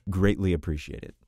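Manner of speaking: ordinary delivery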